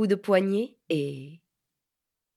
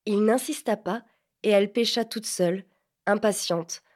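plain reading voice

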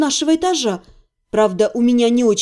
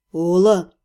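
male lower voice; 8 semitones lower than usual. A narrator's reading. Man pleading, low voice.

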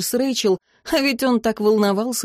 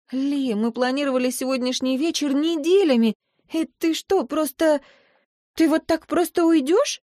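put-on upset girlfriend; 6 semitones higher than usual. A narrator's reading. Rachel upset at date ending.

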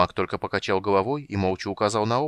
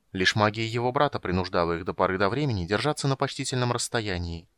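ordinary delivery, nothing marked

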